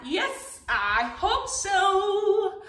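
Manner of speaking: high, drawn-out singsong reply; excited answer. Real, not acted. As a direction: stretch it out high for little listeners. Answering the children after song.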